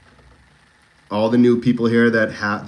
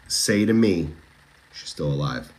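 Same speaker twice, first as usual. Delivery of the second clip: ordinary talk, nothing marked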